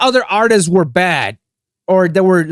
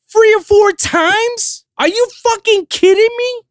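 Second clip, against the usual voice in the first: incredulous outrage. Acted. Outraged at [name]'s nominations.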